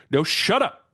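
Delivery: high, loud irritated retort; snapping shut up. Retold or imitated. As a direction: cut her off, annoyed and loud. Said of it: "He's like".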